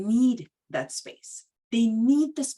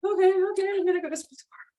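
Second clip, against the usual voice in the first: put-on resigned kid; 8 semitones higher, much faster than usual. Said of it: "I was like".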